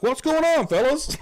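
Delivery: high, hearty put-on hail; mechanic greeting. Fake mechanic rolling up to the group, high hammed-up greeting. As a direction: imitate a mechanic cheerily calling to the guys.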